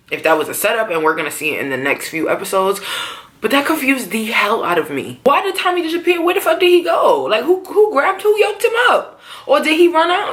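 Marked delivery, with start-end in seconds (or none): 5.2-10.3 higher, pitched-up disbelief at the plot; incredulous outburst. Real, not acted. Fan venting to viewers, pitched-up incredulous questions. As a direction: fire off the questions like you can't believe it. Confused rant about vanished character.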